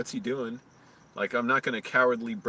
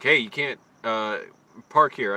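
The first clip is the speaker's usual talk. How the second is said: retold shout; 6 dB louder than usual. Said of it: "he's like".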